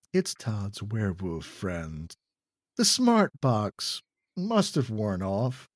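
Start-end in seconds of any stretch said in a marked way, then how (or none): none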